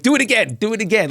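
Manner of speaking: put-on high, loud childlike do it again; excited plea. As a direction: beg like a kid seeing a magic trick. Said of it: "Like it was a magic trick".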